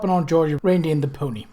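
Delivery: plain reading voice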